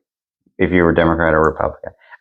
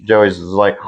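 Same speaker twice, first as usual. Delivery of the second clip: usual speaking voice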